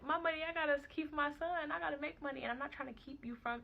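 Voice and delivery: retold frantic self-justifying; worked-up protest. Mother fretting over money and her son, worked up.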